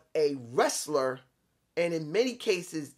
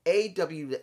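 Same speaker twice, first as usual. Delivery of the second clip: ordinary delivery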